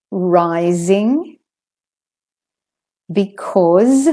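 "Rising, because" with voiced z; word-list model, z in "Rising, because". Shown as a demonstration. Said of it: "where the S says Z".

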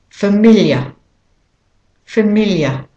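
familiar ending in a schwa, British or Australian style; British or Australian familiar, drop the final R; British or Australian accent. Shown as a demonstration. Teacher modelling for learners, British or Australian accent on familiar. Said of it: "practicing a British or an Australian accent".

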